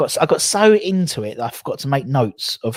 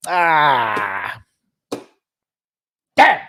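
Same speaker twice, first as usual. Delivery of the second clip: frustrated cry then curse; angry outburst; 4 dB louder than usual. Real, not acted. Angry about missing the pub, sudden frustrated cry. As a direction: let the frustration burst out. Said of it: "I'm just angry".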